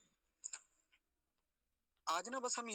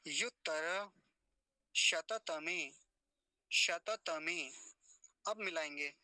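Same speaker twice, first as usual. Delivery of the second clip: repeat after me; slower than usual. Shown as a demonstration. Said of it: "मेरे साथ बोलिए".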